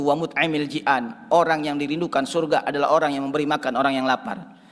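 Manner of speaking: ordinary talk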